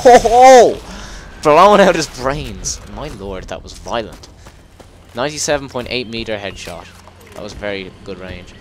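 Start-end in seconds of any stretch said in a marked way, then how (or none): 0.1-2.8 high, loud, drawn-out cheer; excited whoop. Real, not acted. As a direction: crow loudly over a perfect shot. Whooping at a gory headshot.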